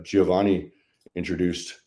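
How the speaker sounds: ordinary talk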